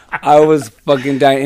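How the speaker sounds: normal talking voice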